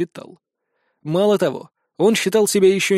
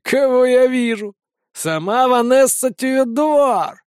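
mocking; 6 semitones higher, 3 dB louder, slower than usual. Vincent mocking greeting.

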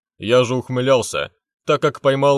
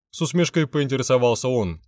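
plain reading voice

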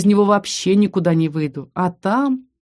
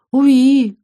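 sad squeal; 7 semitones higher, 5 dB louder than usual. Acted. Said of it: "грустно подтвердил дракончик".

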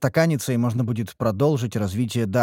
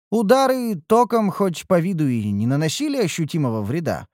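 ordinary delivery

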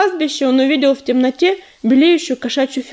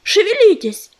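urgent shout; 7 semitones higher than usual. A narrator's reading. Urging cats to run.

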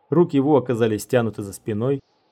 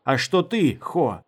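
raised, high-starting challenge; called out; 4 semitones higher, slower than usual. A narrator's reading. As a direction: call the question out, pitched up at the start. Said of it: "крикнул он".

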